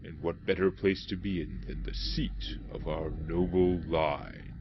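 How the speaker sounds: amateur copy of the game's narrator; half-successful solemn narrator impression; attempted narrator voice. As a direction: lightly mimic a grave narrator, drawing out the phrase. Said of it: "Yeah, cannot do that voice".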